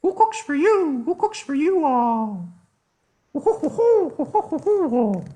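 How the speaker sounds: high, slow, loud hooting owl; barred owl call. Shown as a demonstration. Teacher demonstrating the owl's call, loud high hoot. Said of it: "the owl who says".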